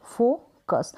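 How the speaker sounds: Focus as a long फो, then कस; split into syllables. Shown as a demonstration. Teacher models the O sound, focus in two pieces. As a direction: hold the O in focus, then add कस. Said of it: "ओ को ओ".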